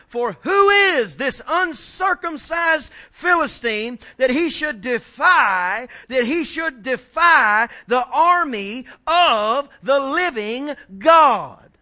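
loud, very slow, stretched-out declamation; thundering scripture preaching. Acted. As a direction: hammer out the defiant challenge word by word. Preaches scripture at full force.

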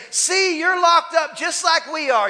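high, loud jeering call across the cell; mock taunt. Fellow prisoner jeering at the believer, loud mocking shout. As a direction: taunt them like a mocking cellmate.

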